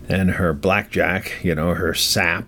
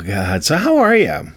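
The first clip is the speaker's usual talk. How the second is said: usual speaking voice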